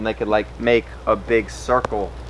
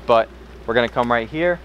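normal talking voice

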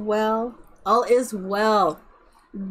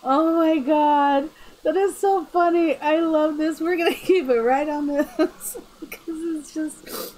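high giggly talk; still giggling; 5 semitones higher than usual. Real, not acted. Streamer still giggling over the funny build. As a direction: keep the laugh bubbling under the words.

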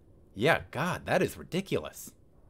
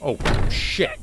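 panicked; 8 semitones higher, 5 dB louder than usual. Real, not acted. Monster appears, player panics.

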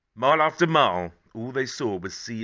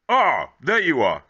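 slow, loud and higher as a cheerful grandad; Grandad's voice; 5 semitones higher, 10 dB louder, slower than usual. Acted. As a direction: call out like a delighted grandad finding the boy. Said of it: "said Grandad".

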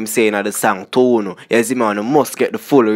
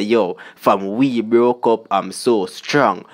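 slow lyric quote; slower than usual. Retold or imitated. Quoting the lyric again.

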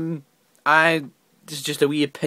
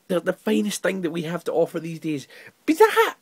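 usual speaking voice

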